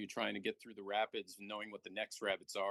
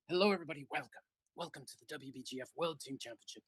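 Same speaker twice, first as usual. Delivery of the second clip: raised, lively British announcer opening the broadcast; excited British announcer; 4 semitones higher than usual. Pretend. Welcoming viewers to the championship, in an excited British announcer voice. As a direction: greet the crowd like a thrilled British sportscaster. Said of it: "with an excited British announcer's voice".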